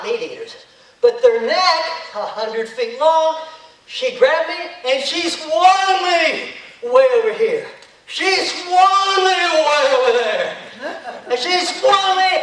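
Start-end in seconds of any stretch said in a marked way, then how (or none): 3.9-12.3 swung around excitedly. Acted. Dinosaur swinging him around.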